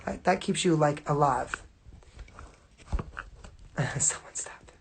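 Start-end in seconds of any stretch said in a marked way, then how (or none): 3.8-4.7 breathy laugh. Real, not acted. Laughing at herself mid-story.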